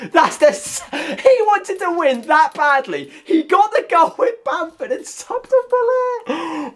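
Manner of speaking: high, slow, disbelieving rant; incredulous outrage. Real, not acted. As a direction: squeak out the outrage. Outraged at opponent's substitution.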